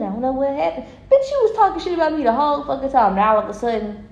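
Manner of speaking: ordinary talk, nothing marked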